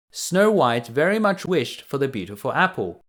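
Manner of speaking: plain reading voice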